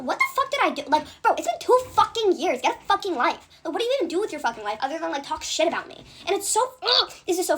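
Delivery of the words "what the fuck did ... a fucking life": angry outburst. Real, not acted. Venting anger at old friends.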